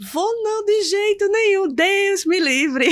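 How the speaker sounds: rifiuto esagerato, molto acuto; rifiuto teatrale. Retold or imitated. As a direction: respingi l'idea con enfasi comica, allungando la fine. Acts out refusing to marry him.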